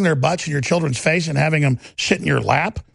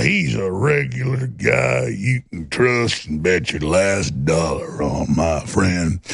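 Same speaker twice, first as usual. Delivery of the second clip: lower, slow down-home salesman voice; folksy pitchman; 5 semitones lower, slower than usual. Retold or imitated. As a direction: sell him like a smooth country huckster, slow. Mocking folksy Beto pitchman.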